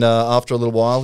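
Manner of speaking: ordinary talk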